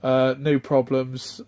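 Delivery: ordinary delivery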